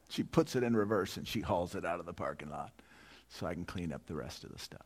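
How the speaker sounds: usual speaking voice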